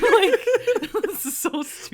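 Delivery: high laughing burst; laughing at how dumb it is. Real, not acted. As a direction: crack up saying it.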